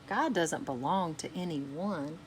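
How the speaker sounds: ordinary delivery, nothing marked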